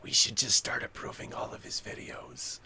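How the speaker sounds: algorithm voice. Retold or imitated. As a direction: murmur it like a conspiring computer. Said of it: "the YouTube algorithm goes".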